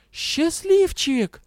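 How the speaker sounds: high, slow, drawn-out outcry; indignant exclamation. A narrator's reading. Heroine scolding the shameless dragon, indignant. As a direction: cry out the name in outrage. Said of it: "возмущённо воскликнула я".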